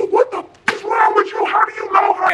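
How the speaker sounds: high-pitched strained outburst; imitated yelling. Man triggered to oblivion, high strained yelling. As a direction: mimic him yelling, exasperated. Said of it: "He's yelling at me".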